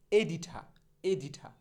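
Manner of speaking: এডিঠা with aspirated ঠ and open আ, no r; corrected pronunciation. Shown as a demonstration. Teacher drilling editor with learners, এডিঠা with aspirated "tha". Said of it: "তাহলে কি বলবো".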